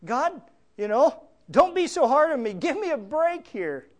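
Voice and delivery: high, hammed-up pleading with God; David crying out. Preacher paraphrases David's prayer for healing, crying out. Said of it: "he cries out in a very practical sense".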